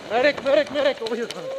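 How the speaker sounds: man down shout. Real, not acted. Calling for medic under fire.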